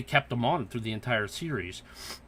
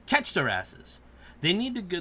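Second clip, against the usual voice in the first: usual speaking voice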